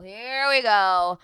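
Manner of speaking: loud and slow, stretching each word; exasperated drawn-out here we go. Retold or imitated. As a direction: savor the coming drama, loudly. Bracing for drama.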